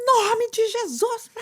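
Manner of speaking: high, drawn-out, shouted rebuke; sanguine prophetic cry. Shown as a demonstration. Pastor showing her expressive sanguine style, high slow cry. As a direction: proclaim it big and high, slow. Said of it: "eu falo com as mãos".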